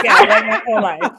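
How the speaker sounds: real laughter through the words; laughing. Real, not acted. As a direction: talk while cracking up. Delighted laughing reply to teasing.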